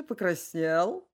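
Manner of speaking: plain reading voice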